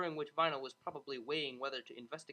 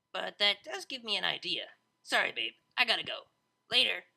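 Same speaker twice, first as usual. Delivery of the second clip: rising louder, quick DJ sign-off; hurried excited goodbye; 4 semitones higher, 8 dB louder than usual. Acted. Vinyl cutting the call with Octavia, getting louder and hurried. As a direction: rush off the phone with a new idea.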